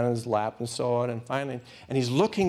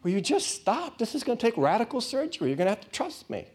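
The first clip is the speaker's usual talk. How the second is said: higher, impatient plea to stop; a fed-up but caring voice; exasperated Lord; 9 semitones higher than usual. Retold or imitated. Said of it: "And the Lord is saying".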